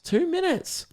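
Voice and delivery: high-pitched disbelief; surprised. Real, not acted. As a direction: shoot up in shock. Shocked at time left.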